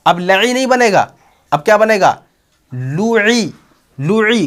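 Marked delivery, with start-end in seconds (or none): 2.7-4.5 higher "लुई" with u in the first syllable; joined-sound demo. Shown as a demonstration. Teacher showing lu is carried over, higher sustained "लुई". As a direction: join "लू" and "ई" into "लुई". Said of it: "क्योंकि आपने लू पढ़ा है".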